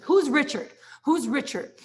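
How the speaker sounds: loud, high, insistent calling out; calling out the name, high and loud; demanding call. Retold or imitated. Coworker barging into the team meeting, loud demanding call.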